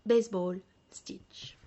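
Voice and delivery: "Baseball stitch" whispered softly; whispered term. Shown as a demonstration. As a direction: breathe "Baseball stitch" as an aside. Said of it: "repeats the term softly". ("Baseball stitch" chuchoté)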